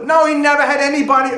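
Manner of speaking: loud, high-pitched contradiction; yelled heckle. Acted. Mourner interrupts praise of the dead man, yelled objection. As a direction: cut in and shout him down. Heckler cuts off the eulogy again.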